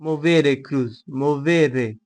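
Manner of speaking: put-on slow, drawn-out Italian accent on "Movere cruz". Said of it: "मैं Italian accent में क्यों बोला यार?". (इटालियन लहजे में "Movere cruz")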